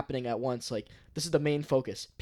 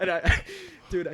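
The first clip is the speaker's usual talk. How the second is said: high laugh breaking through; 4 dB louder than usual. Real, not acted. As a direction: laugh while telling it. Laughing at the story.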